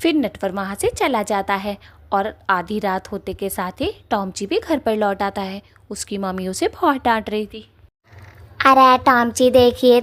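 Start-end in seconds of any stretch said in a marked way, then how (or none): 0.0-7.7 softer, lower narrator voice; calm storyteller bridging the scenes; quiet, low narration; story narration. A narrator's reading.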